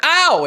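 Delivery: high, loud "ow" yelp; pained yelp. Retold or imitated. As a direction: yelp as if jabbed, re-enacted. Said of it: "I made a sound".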